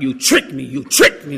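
mildly heated mock accusation; angry accusation. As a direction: hammer out the accusation as if cussing someone out. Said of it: "So I was mad ... cursed the ex-wife out".

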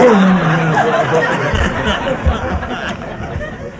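warm chuckle at own punchline; real amused laugh; laughter. Real, not acted. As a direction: laugh at the joke.

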